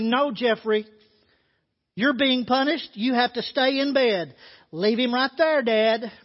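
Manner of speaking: a hint of a stern mother; lightly voiced mother laying down the law. Mother refusing to let grandpa lift Jeffrey out, mildly stern. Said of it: "spoke sternly".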